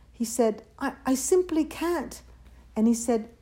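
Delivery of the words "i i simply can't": high, stammered refusal; apologetic refusal. A narrator's reading. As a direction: falter as a reluctant child. Timid chick refuses meat.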